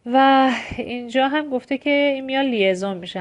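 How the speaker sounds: normal talking voice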